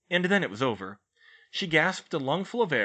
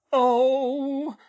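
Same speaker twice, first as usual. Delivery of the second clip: moaning; 8 dB louder than usual. A narrator's reading. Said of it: "Missus Weasley moaned".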